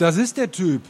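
narrated shout of recognition; calling out. A narrator's reading. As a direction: point someone out to the group. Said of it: "Ein Mann ruft".

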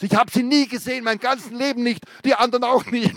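high, loud frantic denial; desperate yelling. Acted. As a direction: keep screaming it. Screamed denial continues.

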